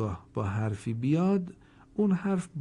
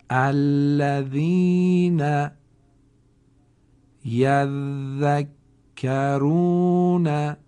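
very slow, much louder chanted recitation; Quranic recitation; 6 dB louder, much slower than usual. Shown as a demonstration. Teacher reciting a Quran example for students, slow and chanted. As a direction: chant slowly and hold each doubled letter. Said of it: "دقت بفرمایید، در ادای تشدید نباید عجله بکنید".

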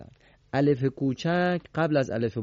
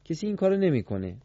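ordinary delivery

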